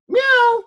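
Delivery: very high, thin meow; cat meow. Retold or imitated. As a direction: mew like a small stray cat. Imitating a stray cat.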